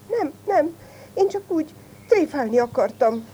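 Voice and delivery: timid, high-pitched backpedalling; stammering. A narrator's reading. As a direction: squeak out an excuse like a scared little fox.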